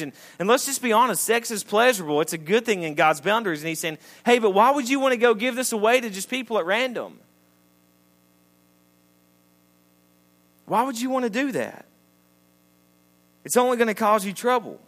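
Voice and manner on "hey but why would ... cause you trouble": incredulous challenge. Acted. Voicing Solomon's exasperated challenge.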